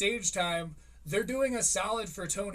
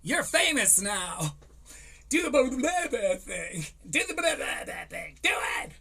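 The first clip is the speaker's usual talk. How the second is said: louder, higher, gushing mock hype; hyped-up mock praise; 5 semitones higher, 6 dB louder than usual. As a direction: pump the kid up like a showman. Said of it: "and was like".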